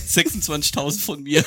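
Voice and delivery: laughing while speaking; cracking up mid-sentence. Real, not acted. Laughing at clip.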